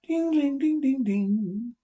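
high dings slowing down, like a ticking machine; Henry listens at the robot's chest, slow mechanical dings; mechanical heartbeat dings. Acted. Robot's heartbeat heard through chest.

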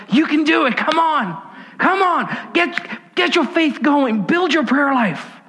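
high-pitched, urging cheerleader cries; cheering on. Acted. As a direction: cheer them on like an excited crowd. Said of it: "they are cheering you on".

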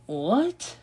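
high, stunned disbelief; can't believe what he's reading; a sharp high cry of disbelief; shocked disbelief. Real, not acted. Shocked at the draft result.

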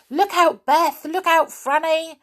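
yelled warning. Acted. Joe shouting to Beth and Franny to dodge the water, loud alarm. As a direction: shout to get them out of the way. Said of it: "he yelled".

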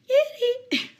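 squeaky call to a cat that breaks; failed high pitch. Shown as a demonstration. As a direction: voice cracks reaching for high pitch. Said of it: "if I try to speak in, like, a l-, high pitch".

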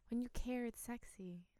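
soft breathy whisper. Acted. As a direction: drop to a whisper. Whispering about sexy.

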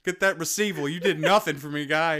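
loud, hammed-up complaining; mock outrage. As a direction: play up the betrayal, loud. Mock outrage, missed high five.

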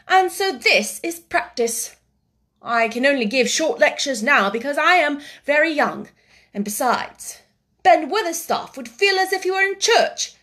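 put-on high, loud, lecturing boy's voice; Colin, self-important boy. Boy explaining why he practices lectures, high and grand. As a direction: declaim like a very young professor.

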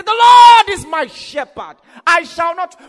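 loud, high, slow shout; bold declaration. Acted. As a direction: declare it like David, full force. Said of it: "borrow a leaf from David and say".

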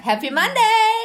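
high, loud video-host cheer; exuberant greeting. Retold or imitated. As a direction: call it out brightly to followers. Retelling greeting shouted in video.

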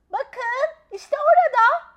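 put-on high, loud child's cry; shouting. A narrator's reading. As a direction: shout and point at it. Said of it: "diye bağırdı Begüm". (gergedanı gösterip bağıran kız)

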